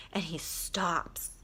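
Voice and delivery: slow hushed storyteller whisper; dramatic whisper. A narrator's reading. Telling viewers about the shy boy, slow whisper. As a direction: breathe it out for suspense.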